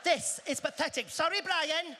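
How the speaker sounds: grumbling Brit. Retold or imitated. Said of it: "us British behind the Americans".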